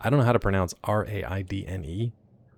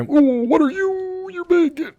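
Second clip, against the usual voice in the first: very high, slow mock squeak; tiny terrified squeak at a giant monster; squeaky frightened voice; 3 dB louder, slower than usual.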